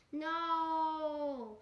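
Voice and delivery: hammed-up wail of protest, held long; mock dismayed wail. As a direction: cry out in cartoonish dismay. Sonic's wail at the threat.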